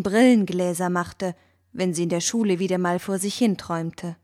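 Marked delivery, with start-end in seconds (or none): none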